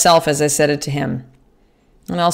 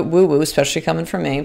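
ordinary talk

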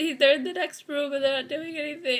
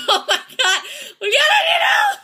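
high shriek breaking into laughter; panicked scream for help; 10 dB louder than usual. Real, not acted. Player begging Julian for help, hysterical laughing scream. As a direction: scream loudly, half laughing in terror.

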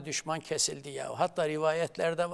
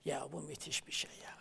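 awed whisper; 11 dB quieter than usual. Real, not acted. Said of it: "awed remark, whispered".

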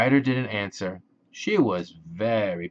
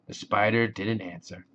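ordinary delivery

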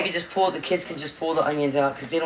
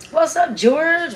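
drawn-out excited greeting; 10 semitones higher, 4 dB louder, much slower than usual. Real, not acted.

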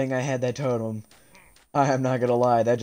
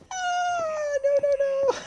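high panicked scream; panicked shriek; slower than usual. Real, not acted. Panicked at a mob.

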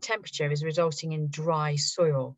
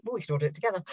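normal talking voice

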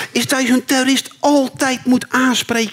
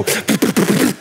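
whispered brut brut; mock gunfire; 4 semitones lower than usual. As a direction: imitate an AK burst. Gunfire sound imitation.

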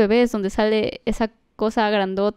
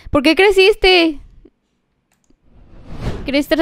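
high, loud, slow question; drawn-out disbelief; baffled; 6 semitones higher, 4 dB louder, slower than usual. Real, not acted. Streamer asks the grown baby in disbelief.

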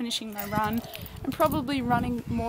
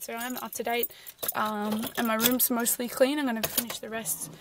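ordinary talk

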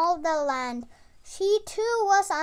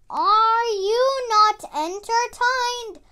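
slow, loud, higher challenge to the crowd; gladiator taunt; 5 semitones higher, 6 dB louder than usual. Acted. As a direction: call out to the arena like a gladiator.

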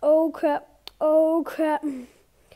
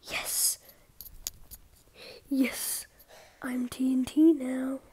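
whispering; 3 semitones lower, 5 dB quieter than usual. Real, not acted. Hiding, whispers.

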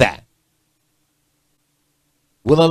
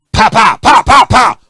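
gunshot noises; 8 semitones higher, 6 dB louder, slower than usual. Retold or imitated. Imitating gunshots.

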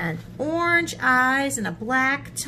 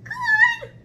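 very high, loud squeak; indignant mouse; 7 dB louder than usual. Acted. Mouse answering the hungry Gruffalo, high indignation. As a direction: squeak it back in outrage.

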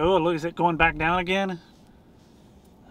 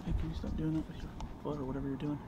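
softer, turned away from the mic; quiet aside; 13 dB quieter than usual. Real, not acted. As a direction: say it quietly to the person beside you. Off-mic aside to someone nearby.

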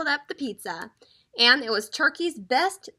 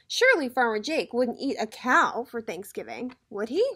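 ordinary delivery, nothing marked

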